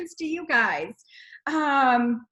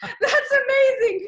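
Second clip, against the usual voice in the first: laughing; 9 semitones higher, 8 dB louder, slower than usual. Real, not acted. Reacting to six days.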